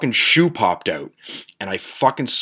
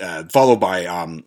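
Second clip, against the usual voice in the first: ordinary talk, nothing marked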